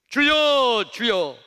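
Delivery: high, very loud cry for the Lord; desperate calling out. Acted. Drowning disciples waking Jesus in the storm, crying out. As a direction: shout to wake him, voice thrown high.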